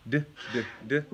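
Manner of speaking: "d" as short "duh", repeated; D letter sound. Shown as a demonstration. Parent teaching phonics dance, repeated short duh sounds. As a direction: say "d, d, d" as separate duh sounds, not the letter name.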